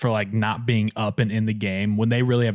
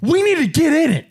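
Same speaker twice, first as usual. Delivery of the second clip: pissed coach; 11 semitones higher than usual. Retold or imitated. Baseball coach yelling at his dead bench, mock high rant. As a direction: chew out the dugout like a mad coach. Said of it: "It's just like".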